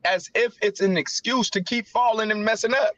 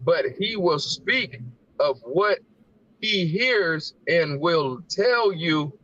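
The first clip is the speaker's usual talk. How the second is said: slow, measured reading of the verse; scripture reading; 5 semitones lower, slower than usual. Acted. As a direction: read the holy words slowly and carefully. Reads scripture aloud.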